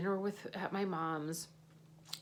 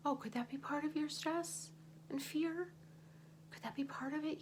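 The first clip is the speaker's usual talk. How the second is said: gentle self-inquiry; 8 semitones higher, 4 dB quieter than usual. Pretend. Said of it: "gently questioning herself".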